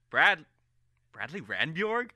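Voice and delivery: surprised. Acted. Colm hears rival's name.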